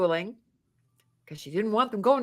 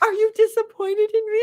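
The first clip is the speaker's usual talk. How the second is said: teasing high plea; 11 semitones higher, 3 dB louder than usual. Retold or imitated. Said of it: "and I go".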